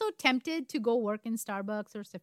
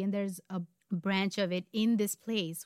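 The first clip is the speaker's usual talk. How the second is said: ordinary delivery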